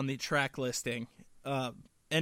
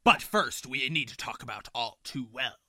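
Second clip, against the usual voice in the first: Captain Barboza; 3 semitones lower than usual. Acted. Co-host correcting the SpongeBob guess, pirate captain voice. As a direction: announce it like a proud pirate captain. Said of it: "you're still using your pirate voice".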